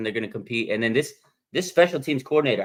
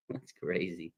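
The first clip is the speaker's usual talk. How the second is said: ordinary talk, nothing marked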